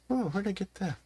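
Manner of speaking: high, distracted old trader voice; puzzled merchant. Merchant puzzles over a shiny rock, high and bemused. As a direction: muse aloud like a scatterbrained peddler. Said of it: "he's doing that guy every time".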